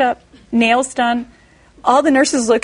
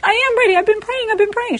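high mock pleading to the nurse; high pleading protest; 10 semitones higher than usual. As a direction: plead your case to the nurse.

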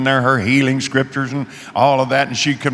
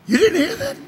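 far higher, mock incredulous voice; incredulous question. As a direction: ask in disbelief, pitch shooting up. Kidnapper disbelieving hostage.

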